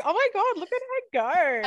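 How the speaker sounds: excited. Retold or imitated. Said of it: "I was like".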